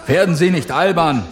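rebuke. A narrator's reading. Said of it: "rügte der Doktor sie".

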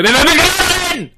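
clipping, overdriven microphone; blown-out mic distortion; distorted loud mic. Real, not acted. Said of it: "Toll, jetzt sind sie wieder tot".

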